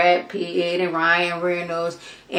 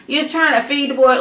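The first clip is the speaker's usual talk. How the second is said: ordinary talk, nothing marked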